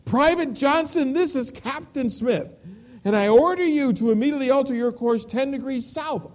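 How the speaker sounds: shouted radio order. Acted. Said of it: "the captain shouted back over the radio".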